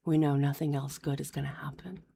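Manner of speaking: fast, despairing whisper; mock emo whisper; gloomy whisper. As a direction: whisper it as if all hope is gone.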